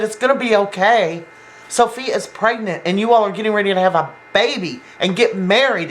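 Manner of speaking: worked-up retort, high-pitched and indignant; having a fit. Retold or imitated. Girlfriend throwing reassurance back at boyfriend, having a fit. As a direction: imitate an outraged girlfriend, voice jumping up high. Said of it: "she's having a fit".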